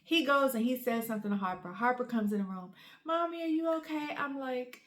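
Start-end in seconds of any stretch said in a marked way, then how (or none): 3.0-4.1 higher, lightly voiced little kid; small concerned kid voice; worried child. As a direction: imitate a child gently asking after mom. Voicing her second child.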